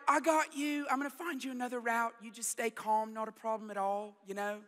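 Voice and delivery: soothing British GPS impression; imitated satnav lady, gently calming; kind British lady. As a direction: reassure the driver in a softening British voice. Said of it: "she's so kind to me".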